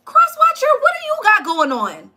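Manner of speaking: high loud shout of the name; sudden high shout; calling out. Real, not acted. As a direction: yell the name to get attention. Calling out the sign.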